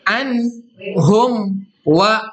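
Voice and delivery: anhum wa chanted very slowly; slow recitation. Shown as a demonstration. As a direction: draw out anhum wa clearly. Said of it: "hukum bacanya disebut dengan izhar syafawi".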